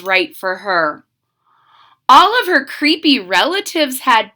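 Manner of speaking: put-on lively read-aloud for kids; storybook narration. A narrator's reading. Reading a witch story to children, lively narration. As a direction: tell it like a bedtime picture book.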